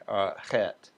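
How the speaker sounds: ordinary delivery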